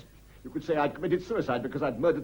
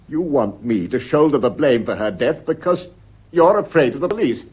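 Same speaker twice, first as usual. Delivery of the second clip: bitter loud accusation; 9 dB louder than usual. Acted. Husband accuses wife of fearing the police, loud and bitter. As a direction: throw the blame back at her.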